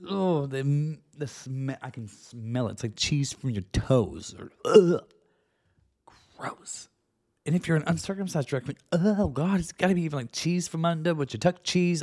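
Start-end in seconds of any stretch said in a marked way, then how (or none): 4.4-6.9 loud ugh then breathless gross; loud disgusted ugh. Real, not acted. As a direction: gag at the thought.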